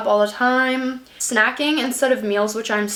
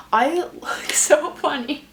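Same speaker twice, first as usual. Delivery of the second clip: laughing; 6 semitones higher than usual. Real, not acted. Laughing at her own reflection.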